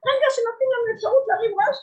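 fast, loud, high sermon peak; rousing. Acted. Preacher stirring the congregation, fast and loud. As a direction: drive the point home urgently.

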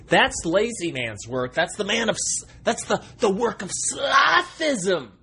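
mock-outraged rant. Comic rant building to a cry.

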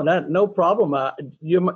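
usual speaking voice

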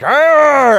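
loud high mock growl; mad dog growl. Nerd kids growling at the big guys, loud mock snarl. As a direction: snarl like a rabid little dog. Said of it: "they look like mad dogs".

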